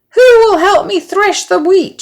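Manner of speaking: high, loud call across the yard. Acted. Hen calls early one morning for help, loud and high. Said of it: "she called out".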